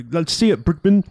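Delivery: high mock Russian-Australian accent; imitated pseudo Russian-Australian accent. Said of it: "weird pseudo Russian Australian thing".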